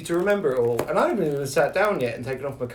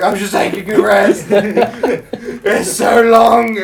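laughing breathless; 6 semitones higher, 8 dB louder, slower than usual. Retold or imitated. Out of breath on hill.

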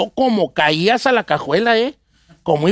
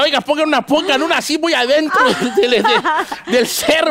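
laughing joke; 7 semitones higher than usual. Retold or imitated. Joking request to migra, laughing.

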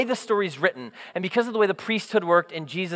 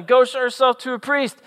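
retold yell; 6 semitones higher than usual. Jesus yelling at the lepers, retold yell. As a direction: imitate calling to people at a distance, pitched up. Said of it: "he yells at them".

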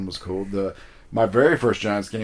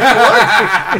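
high, loud, laughing what; startled laughing what; 12 semitones higher, 13 dB louder than usual. Retold or imitated. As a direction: blurt it in laughing disbelief. Baffled by the fan's outburst.

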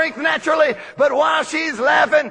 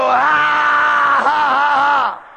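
loud, bright mock laugh; believing laugh; 3 dB louder, much slower than usual. Shown as a demonstration. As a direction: laugh out in faith. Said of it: "You just believe and go".

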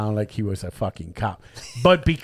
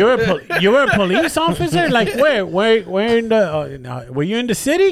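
mock eager grilling; 9 semitones higher, 4 dB louder than usual. Grilling the fake cop.

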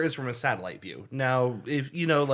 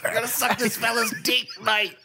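higher hammed-up Aussie bloke; mock Australian; 6 semitones higher than usual. Mocking the climber as an Australian, higher hammed-up accent. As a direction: pitch it up like a silly Aussie bloke.

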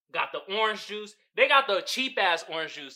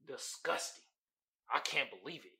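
mock disgust; 5 semitones lower, 11 dB quieter than usual. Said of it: "oh, I'm disgusted".

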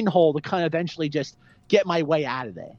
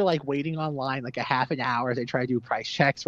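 ordinary talk

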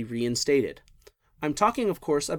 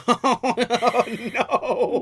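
high, loud laughing "oh no"; cracking up at the joke; laughing protest; 9 semitones higher, 4 dB louder than usual. Real, not acted. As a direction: burst out laughing in mock protest. Reacting to an obscure joke.

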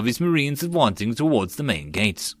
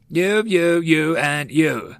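raised, higher picking-out of men; snapped selection; 6 semitones higher, much slower than usual. A narrator's reading. Sergeant picking out volunteers, snapping. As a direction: snap each word at a different man. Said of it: "The sergeant snapped and picked up".

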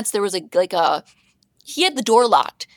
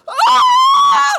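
loud, very high shriek; excited scream; 8 dB louder than usual. Real, not acted. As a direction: scream out the embarrassment of that hug. Reliving the hug, mortified.

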